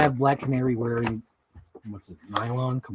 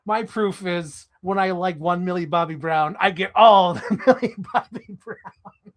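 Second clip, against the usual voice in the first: normal talking voice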